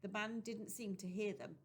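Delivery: ordinary delivery, nothing marked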